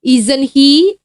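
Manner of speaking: isn't he? lifted up at the end; rising tag. Shown as a demonstration. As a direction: show the tag with a climbing tune. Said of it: "ini bentuk question tag-nya".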